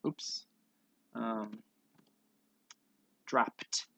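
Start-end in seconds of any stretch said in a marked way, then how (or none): 3.3-3.9 "dropped" ending in a puffed t; final T sound. Shown as a demonstration. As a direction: say "dropped" as one syllable, dropt. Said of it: "Well, with a T".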